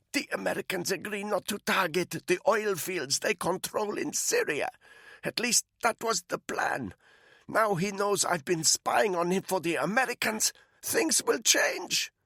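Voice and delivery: a frightened captive pleading; captive woman's voice. Acted. Malinka explains the plan.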